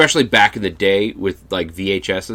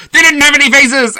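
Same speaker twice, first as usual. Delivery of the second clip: much higher, louder ghost-story punchline; shrill scare climax; 9 dB louder than usual. Retold or imitated. Babysitter ends the ghost story for the kids, shrieking. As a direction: shriek the punchline of the scary tale.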